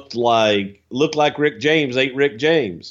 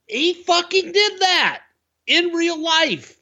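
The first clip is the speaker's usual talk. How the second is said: excited emphasis. Real, not acted. Emphatic disbelief.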